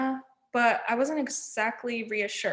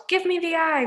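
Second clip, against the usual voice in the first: high, louder demand; yelling crone; yelled; 6 semitones higher, 4 dB louder than usual. A narrator's reading. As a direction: shriek for her eye. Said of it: "Wasp yelled".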